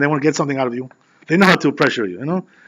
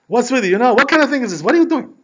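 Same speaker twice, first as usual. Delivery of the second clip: much higher, incredulous acted questions; baffled demand; 8 semitones higher, 4 dB louder than usual. Lady confronts the rabbi over his odd behaviour, incredulous. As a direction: climb in pitch with each baffled question.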